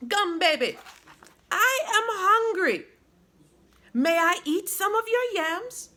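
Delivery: high, loud pleading fairy voice; a little fairy begging for food, pitched high; hungry fairy cry. A narrator's reading. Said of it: "Momosha cried".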